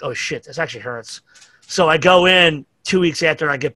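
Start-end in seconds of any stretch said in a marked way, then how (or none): 0.0-1.2 soft, low mock wince; pained realization. Hitting a concrete pin with the broken hand, soft and pained. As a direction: let the pain sneak up under the breath.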